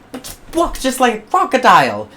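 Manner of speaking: high, loud objection; eager protest. Acted. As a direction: blurt it out. George protesting.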